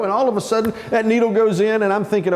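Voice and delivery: normal talking voice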